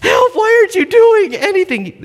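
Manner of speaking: put-on high, loud panicked child's wail; whiny kid crying for help. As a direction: wail like a little kid stuck in mud. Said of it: "But he's like".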